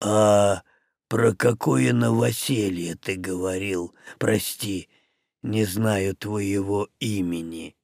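deep, careful and courteous; polite orc. Acted. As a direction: orc asking Мух's name, low and polite. Orc voice held.

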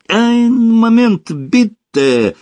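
high, loud, drawn-out mock-German answer; playful eager reply. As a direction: sing out eagerly, stretching the words. Kostya playfully answers the hurry-up.

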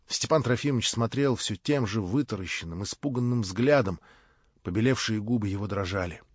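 ordinary delivery, nothing marked

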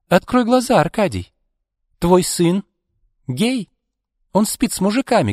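very high, triumphant jibe; taunting revelation. A narrator's reading. Izolda reveals his son's secret to Arkady, taunting.

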